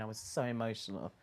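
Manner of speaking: ordinary delivery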